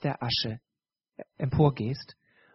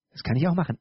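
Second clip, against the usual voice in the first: ordinary talk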